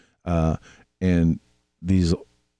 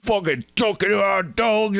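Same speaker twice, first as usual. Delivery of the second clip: high, put-on foreign accent, not quite Irish; vague foreign accent; 12 semitones higher than usual. Angry islander scolding American tourists, in a high put-on foreign accent. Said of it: "that was not an Irish accent I did, but it sounded foreign".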